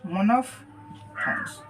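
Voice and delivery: मोनोफ्थॉन्ग्स said slowly and softly, syllable by syllable; softly drawn out मोनोफ्थॉन्ग्स; slow pronunciation model. Shown as a demonstration. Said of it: "पहला का नाम रखा है मोनोफ्थॉन्ग्स".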